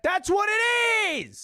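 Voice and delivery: high pitched yell; shout. Real, not acted. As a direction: shout it in protest. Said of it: "Don't say it like that".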